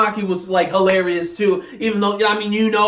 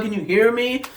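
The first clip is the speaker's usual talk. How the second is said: usual speaking voice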